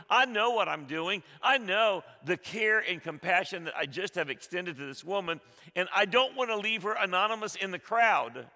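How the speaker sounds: loud, high, fervent preaching; preaching at full force. Acted. Preacher urging the congregation, loud and fervent. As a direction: drive it home to the congregation.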